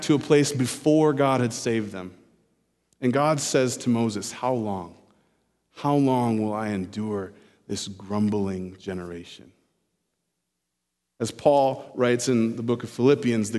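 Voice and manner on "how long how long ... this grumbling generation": weary God. Retold or imitated. God's weary lament to Moses.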